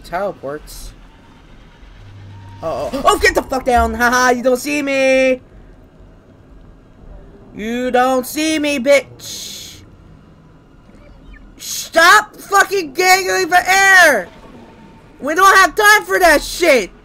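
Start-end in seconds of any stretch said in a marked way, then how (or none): 3.0-9.8 high, loud gloating at an enemy; frantic taunting. Real, not acted. Player taunts monster that can't see him, frantic gloating. 11.6-16.9 very high, loud exasperated shouting; furious yell. Real, not acted. Player yells at character gasping for air, furious yell.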